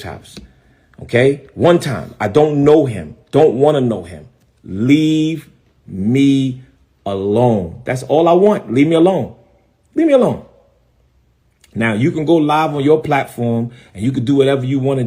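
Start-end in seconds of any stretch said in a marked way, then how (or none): 4.6-7.8 one word at a time, long pauses; emphatic warning. Real, not acted. Pastor warning off a rival, slow word-by-word demand. 9.9-10.4 high, quick burst falling at the end; insistent demand. Real, not acted. Pastor warning off a rival, high quick insistent demand.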